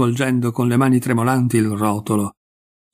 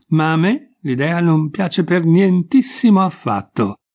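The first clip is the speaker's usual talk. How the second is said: higher, slow, emphatic objection; emphatic disapproval; 6 semitones higher, slower than usual. A narrator's reading. Mother objects to her daughter's idea, slow and emphatic. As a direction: draw out the displeasure.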